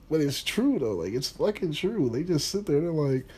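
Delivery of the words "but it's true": ordinary talk, nothing marked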